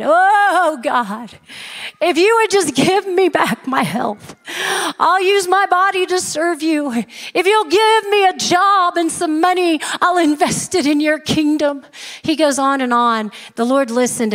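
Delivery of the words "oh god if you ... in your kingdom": high, wailing mock plea; hammed-up begging with a long opening wail; crying out to God. The man is back begging God again, hammed-up crying out. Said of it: "crying out to God".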